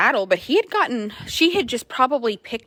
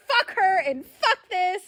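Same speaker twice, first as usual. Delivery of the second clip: higher, slow, laughing defiance; laughing horse voice; 9 semitones higher, slower than usual. Pretend. Owner voices the defiant horse, laughing. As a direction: toss it off through laughter. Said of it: "And he was like".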